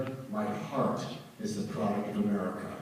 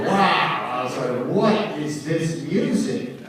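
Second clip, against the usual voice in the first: amazed; 2 semitones higher, 6 dB louder, slower than usual. Retold or imitated. Musician recalling first hearing Sergeant Pepper's, amazed. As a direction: marvel at a new sound, stretching the words.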